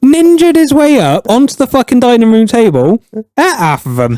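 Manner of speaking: high-pitched indignant answer; comic outrage at the culprit; exasperated. Real, not acted. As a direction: answer as if it's painfully obvious.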